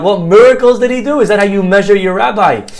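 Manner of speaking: high, loud retold protest; bothered, indignant. Student challenging the kid's question, bothered and indignant. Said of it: "I was so bothered by the question".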